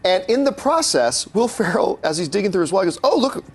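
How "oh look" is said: higher, lightly voiced oh look; a hint of delighted discovery; mock surprise. Retelling Ferrell finding the card, lightly surprised.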